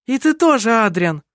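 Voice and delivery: high, aggressive accusation. A narrator's reading. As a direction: turn on him hotly. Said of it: "агрессивно сказала Керстин".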